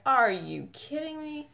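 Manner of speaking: slow, high, incredulous retelling; exasperated disbelief. Retold or imitated. Retelling her exasperation at burning herself.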